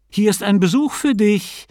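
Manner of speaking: high, bright-pitched announcement; announcing. A narrator's reading. Wife announcing a visitor at the door, announcing. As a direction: call out news of a visitor. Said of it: "und verkündete".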